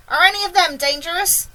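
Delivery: high, loud, anxious query; shouted question. A narrator's reading. Police chief checks for danger, shouting. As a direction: call up warily. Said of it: "shouted the chief of police".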